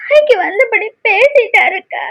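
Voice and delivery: halting account between sobs; sobbing. Acted. Aishu reports the old man's insult to elder sister, sobbing. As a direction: tell it brokenly, crying throughout. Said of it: "அழுதுட்டு வந்திருக்க".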